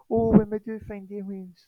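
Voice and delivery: high, mocking woman's voice; Jill Tarter. SETI chair speculating on finding aliens, mock high voice. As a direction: put on a high parody voice. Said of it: "this is Jill Tarter".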